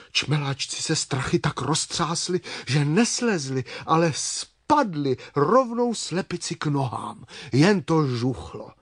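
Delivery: usual speaking voice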